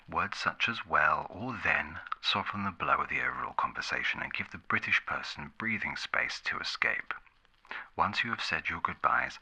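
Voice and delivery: ordinary talk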